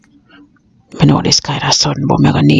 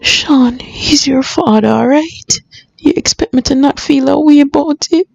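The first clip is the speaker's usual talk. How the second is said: high, distressed reproach; upset; 10 semitones higher, slower than usual. Acted. Grieving mother reproaching Sean about his father, upset. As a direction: reproach him in a pained, high voice.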